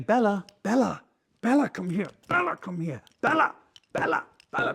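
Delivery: high-pitched, repetitive calling to a dog; parodied owner nagging the dog by name; imitating lazy owner. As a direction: keep calling uselessly from your seat. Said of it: "sitting here on my lazy fat arse".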